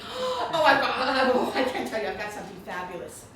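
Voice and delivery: a friend's breathless, giddy news, with a laugh; gushing excitement. Retold or imitated. Retelling a friend's book news, giddy and excited. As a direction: gush it like big news you can't hold in. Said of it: "she gets all excited".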